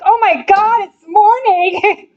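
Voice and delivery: a loud, high, slow mock groan at the morning; hammed-up teenage grumble about waking up; groggy daughter groaning. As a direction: drag out "it's morning" like a grumpy teen. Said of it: "Our daughter would be like".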